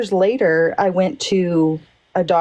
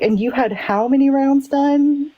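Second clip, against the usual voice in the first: higher, amazed doctor's question; blown away doctor; 6 semitones higher than usual. Retold or imitated. Doctor stunned to hear she had nothing, higher and amazed. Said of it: "he was blown away".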